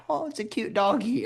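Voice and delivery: high-pitched dog-lover imitation; naive, high mimicked gushing; mock cooing. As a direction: coo mockingly, as at a puppy. Mocking people who coo at coyotes.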